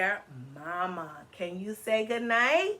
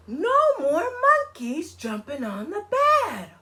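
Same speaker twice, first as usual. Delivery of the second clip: chanted higher, slower doctor voice; doctor's warning; 6 semitones higher, 5 dB louder than usual. Acted. Teacher voicing the doctor for the children. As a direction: sing out the doctor's rule. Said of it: "and the doctor said".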